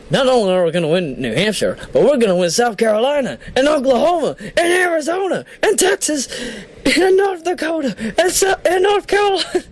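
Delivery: high, rising rally-speech impression; Howard Dean. List reader breaking into a politician's rally speech, building excitement. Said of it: "whenever I hear Howard Dean, I have to do that".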